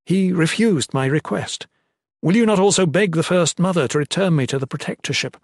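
impassioned appeal for a second chance; pleading. A narrator's reading. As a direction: implore with mounting urgency. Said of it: "he pled".

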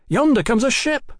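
high, eager exclamation; innocent cry. A narrator's reading. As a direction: point it out excitedly, voice lifted high. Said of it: "Tom cried innocently".

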